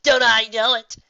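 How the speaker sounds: forced grin. A narrator's reading. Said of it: "hastily added with a clenched grin".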